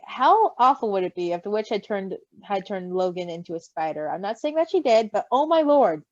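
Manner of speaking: ordinary delivery, nothing marked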